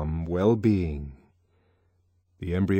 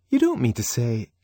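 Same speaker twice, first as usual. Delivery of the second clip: startled; 4 semitones higher, 4 dB louder than usual. A narrator's reading. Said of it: "Fanny looked startled".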